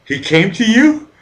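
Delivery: imitating friends' disbelief; astonished question. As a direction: friends asking, stunned, if Jesus came. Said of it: "they tell me".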